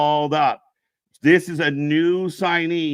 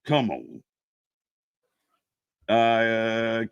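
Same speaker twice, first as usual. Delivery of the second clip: ordinary talk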